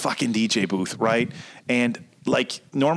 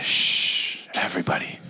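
hushed, softer mock wildlife narrator; mock nature documentary whisper; 8 semitones lower, 4 dB quieter than usual. DJ on the microphone mocking the bird-call guy, in a hushed nature-documentary whisper. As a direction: whisper it like you're stalking a rare bird.

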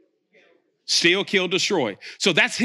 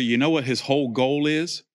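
usual speaking voice

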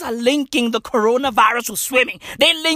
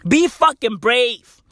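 ordinary delivery

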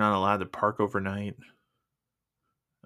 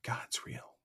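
whispered, much softer; imitated tearful awe; 13 dB quieter than usual. Bawling lady answering the man, tearful whisper. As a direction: imitate weeping wonder in a whisper. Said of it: "and she's bawling".

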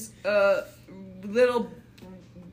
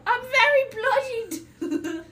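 very high, gasping wounded voice; a dying critter's gasp; wounded soldier gasping; 13 semitones higher than usual. Acted. Wounded rabbit gasps.

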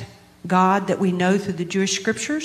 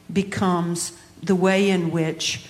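ordinary delivery

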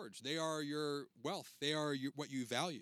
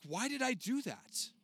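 higher, slow, puzzled at yourself; frustrated self-talk; 9 semitones higher than usual. Retold or imitated. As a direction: ask yourself in dismay. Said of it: "and you're like".

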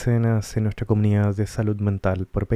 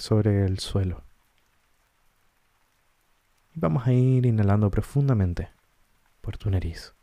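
calm guided-meditation voice with long pauses. Acted. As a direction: guide listeners gently inward, leaving space between short phrases. Guía una meditación con pausas. (voz pausada de meditación guiada)

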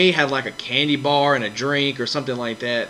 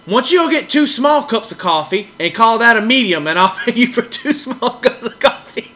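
smart-aleck clerk; 8 semitones higher, 4 dB louder than usual. Retold or imitated. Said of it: "he kinda got smart".

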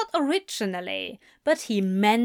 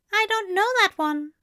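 higher, puzzled; puzzled angel; 11 semitones higher than usual. A narrator's reading. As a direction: frown through it. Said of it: "Aziraphale frowned".

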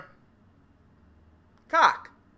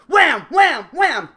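louder, higher punchy wham sounds; shouted wham; 8 semitones higher, 13 dB louder, slower than usual. Acted. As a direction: punch out each wham like a blow. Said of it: "just go".

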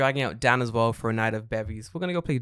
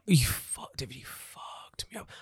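whispered complaint to a friend; hushed, drained-out aside; pained whisper; 6 dB quieter than usual. Real, not acted. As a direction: drop to a voiceless whisper. Complains of pain to co-host.

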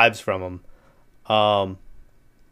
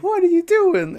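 mock shocked wife. Wife catching him sucking toes.

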